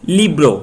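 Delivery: Libre with a loud ली and falling bro; stress on first syllable. Shown as a demonstration. Teacher gives the rule's example, stressing ली in Libre. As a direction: hit ली in Libre, drop bro. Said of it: "एक syllable पहले पे stress".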